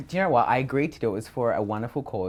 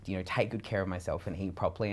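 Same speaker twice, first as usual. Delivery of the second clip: usual speaking voice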